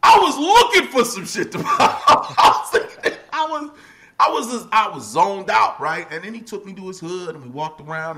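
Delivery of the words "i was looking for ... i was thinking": loud, high, laughing as you speak; laughing outburst. Real, not acted. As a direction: blurt it out while cracking up.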